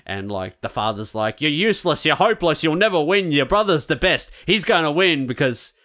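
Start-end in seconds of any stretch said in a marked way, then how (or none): 1.4-5.7 higher and louder, hammed-up harsh dad; berating father. Father in the film berating his younger son, mock harsh rant. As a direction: tear strips off the boy.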